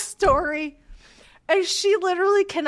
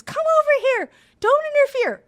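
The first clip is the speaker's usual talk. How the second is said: higher, louder call to a dog; 8 semitones higher, 5 dB louder than usual. Retold or imitated. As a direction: call the dog back across the hill. Owner calling his dog back.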